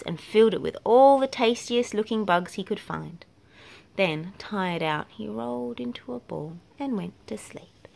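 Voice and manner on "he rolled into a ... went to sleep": whispered softly, drifting off; whispered bedtime refrain; sleepy whisper. A narrator's reading. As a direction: hush it like a lullaby.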